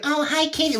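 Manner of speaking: high-pitched mock cooing at a cat; cooing greeting. Drunk audience greeting the brewery cat, mock cooing. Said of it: "they're like".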